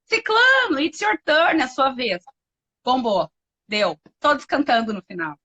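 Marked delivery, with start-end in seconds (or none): 0.0-2.2 calling out names. Retold or imitated. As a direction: call each child's turn over a class microphone. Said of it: "E aí eu chamava".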